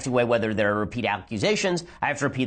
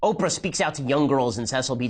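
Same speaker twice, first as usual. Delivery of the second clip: ordinary talk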